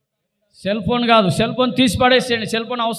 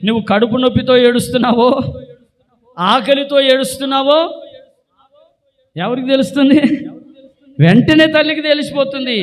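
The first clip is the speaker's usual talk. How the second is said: raised, loud, insistent voice; impassioned preaching; 4 semitones higher, 5 dB louder than usual. Real, not acted. Preacher tells youth how a mother knows, loud and impassioned. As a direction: press each question higher and louder.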